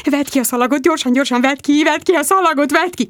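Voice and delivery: high, frantic and rushing; desperate to save the tape; panicked. A narrator's reading. Bridget to her friends, in high frantic panic.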